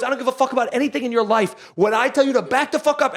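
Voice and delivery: emphatic, hammed-up telling-off; imitated yelling. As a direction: act out a cop laying down the law. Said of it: "he's just yelling in this guy's face".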